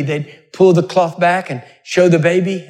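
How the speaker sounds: usual speaking voice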